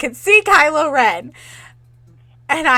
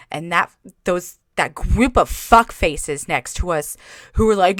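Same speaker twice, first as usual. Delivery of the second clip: normal talking voice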